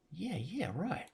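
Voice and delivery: put-on high-pitched, swooping English accent sliding into Aussie; wobbly mock Aussie accent, high and soft. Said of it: "slip into an Aussie".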